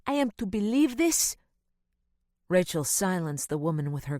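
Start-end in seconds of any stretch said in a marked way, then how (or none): 0.0-1.4 put-on higher, louder accented housekeeper; scornful disbelief in the voice; frowning housekeeper. A narrator's reading. Housekeeper scoffing at the child's excuse, louder.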